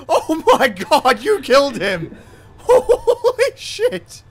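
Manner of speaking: high, loud disbelieving laughter; laughing in shock. Real, not acted. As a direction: crack up in disbelief. Teammate runs over a civilian.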